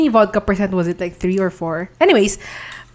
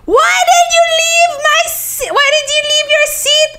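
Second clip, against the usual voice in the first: very high, drawn-out retold scream; slower than usual. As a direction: screech accusingly at your friend. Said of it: "I started screaming at her".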